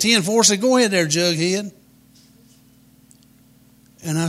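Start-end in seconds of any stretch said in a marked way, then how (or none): 0.0-1.7 high, loud mock CB lingo; hollered CB reply. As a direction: holler it back like a CB nut. Repeats lady's CB reply after aside.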